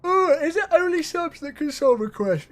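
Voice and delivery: words stretched through a big yawn. Real, not acted. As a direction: yawn right through the question. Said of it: "Excuse me for yawning while saying that".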